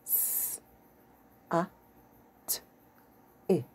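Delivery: s, a, t, i said as separate sounds; sounding out words. Shown as a demonstration. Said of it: "sounded out words using the sounds".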